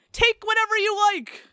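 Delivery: high, bright, cheerful call. A narrator's reading. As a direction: call it out gleefully. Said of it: "call out in a bright tone".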